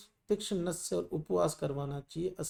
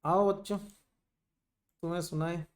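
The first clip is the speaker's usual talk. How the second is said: storyteller; much slower than usual. Pretend. Mock storytime opening to lecture.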